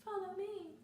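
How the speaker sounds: high, soft luring witch voice; mock witch's beckoning. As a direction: lure them in, high and hushed. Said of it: "And the witch is like".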